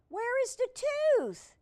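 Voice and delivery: frantic searching. Acted.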